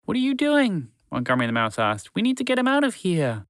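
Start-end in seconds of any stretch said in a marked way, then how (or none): 0.1-0.9 high squeaky mouse, exasperated. Acted. Mouse frustrated at the idle horse, high and sharp. 2.2-3.5 high squeaky mouse, exasperated. Acted. Mouse frustrated at the idle horse, high and sharp.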